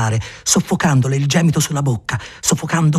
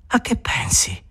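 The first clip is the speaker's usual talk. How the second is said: soft whisper; 5 semitones lower, 4 dB quieter than usual. Acted. Whispered question.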